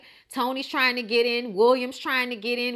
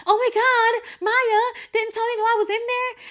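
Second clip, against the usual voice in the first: much higher and louder frantic squealing; hysterical Joan; 11 semitones higher, 5 dB louder than usual. Retold or imitated. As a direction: shriek it like a frantic, overdramatic friend. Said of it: "Joan goes screaming down the hallway".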